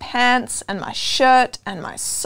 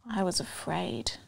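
soft breathless whisper of fear; scared child whispering; fearful whisper; 4 semitones lower, 13 dB quieter than usual. Acted. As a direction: hush it, heart pounding. Boy waits for mum, scared.

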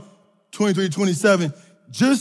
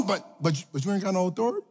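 sudden soft drop; 3 semitones lower, 10 dB quieter, faster than usual. Acted. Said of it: "sudden drop after building".

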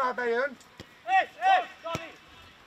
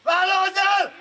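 shouted coaching; 3 semitones higher than usual. Real, not acted. Coach urges player to take it on, shouting. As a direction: holler it to a distant player.

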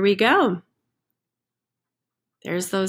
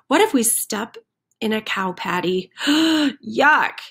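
fretting chickens; 3 semitones higher than usual. Acted. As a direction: pile on the what-ifs nervously.

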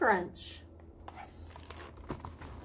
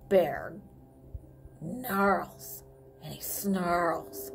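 lower, gruff grumbling read-aloud; reading to children; 5 semitones lower, slower than usual. Acted. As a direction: growl the words a little like a grumpy bear. Reading picture book aloud.

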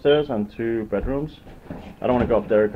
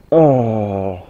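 ordinary talk, nothing marked

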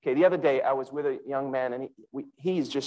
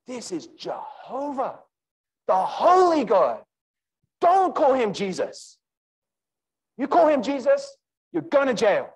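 higher, louder zealous threat, Jehovah first; 6 semitones higher, 3 dB louder than usual. Acted. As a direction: warn them like a zealot. Acting zealous Saul.

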